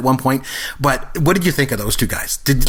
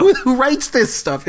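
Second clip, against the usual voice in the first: incredulous; 10 semitones higher, slower than usual. Real, not acted. Amazed at the script.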